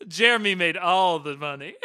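loud, high joke through laughter; amused shout, cracking up; laughing. Real, not acted. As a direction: laugh the line out loudly.

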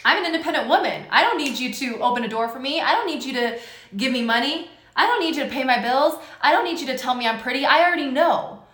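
fast, loud, defiant high voice; defiant independent woman. Retold or imitated. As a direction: rattle it off like a proud independent woman. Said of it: "You're like".

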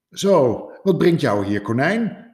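loud, high friendly greeting; cheerful hedgehog. Acted. Hedgehog welcoming rabbit at the door, loud and friendly. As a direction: greet the visitor brightly.

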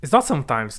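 ordinary talk

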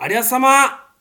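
loud, high Yamagata thank-you; Yamagata dialect. Shown as a demonstration. Asked for his Yamagata dialect, says thanks in it. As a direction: answer in Yamagata dialect on request. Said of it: "山形弁でこのメールに返事はしてくださいよ".

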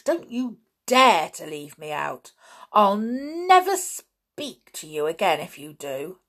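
loud, slow angry threat; flare of temper. A narrator's reading. George threatening Julian, slow angry warning. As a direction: warn him off, fuming. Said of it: "sudden flare-up of temper".

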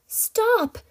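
high shrieking scream. A narrator's reading. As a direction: scream in pain. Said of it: "she screamed".

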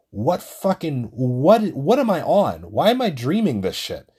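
high, loud panicked outcry, half acted; retold dream scream. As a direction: shout it like waking from a nightmare, reliving it. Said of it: "And I'm here screaming and".

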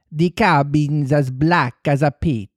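put-on slow Italian-accented report; Italian accent, a touch of horror; gibbering Italian accent. Shaken Italian pirate reporting from the cabin, Italian accent. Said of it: "almost gibbering".

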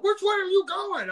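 high, loud and slow menacing demand; angry challenge. Retold or imitated. Furious driver pulling up to confront him, high threatening demand. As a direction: bark the question like a threat. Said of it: "he pulled up".

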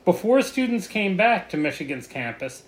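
ordinary talk, nothing marked

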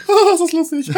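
high laughing cartoon voice; disguised voice. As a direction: giggle in a squeaky put-on voice. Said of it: "Und wir verstellen unsere Stimme".

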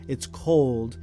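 ordinary talk, nothing marked

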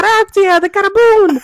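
high, loud hammed-up foreign sign-off; mock Italian flourish. Pleased with her pirate pun, high hammy flourish. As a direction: toss it off like a cheesy showman.

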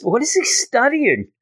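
high-pitched, puzzled question; mock bafflement. Classmate watching Peter at work on day one, mock bafflement. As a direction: lightly act out incredulity. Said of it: "immediately he's like".

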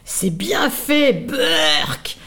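slow, gloating wasp voice with a disgusted burk. Acted. Wasp gloating over the fallen caterpillar, slow and disgusted. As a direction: savour the mockery, then spit out the disgust. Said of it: "ajouta la guêpe".

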